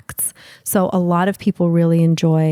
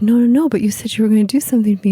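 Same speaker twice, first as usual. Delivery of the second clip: mock protest; 3 semitones higher, 3 dB louder, much faster than usual. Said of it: "you're like".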